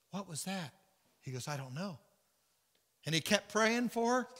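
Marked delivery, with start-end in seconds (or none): 0.1-2.0 hushed unease. Retold or imitated. Retold hushed fright at shaking cupboard.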